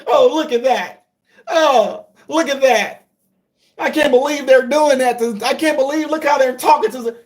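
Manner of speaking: high, whimpering mock outrage; mock-crying sarcastic imitation; mocking whiny critic.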